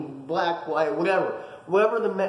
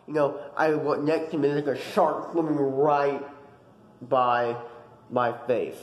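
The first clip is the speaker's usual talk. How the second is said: ordinary talk, nothing marked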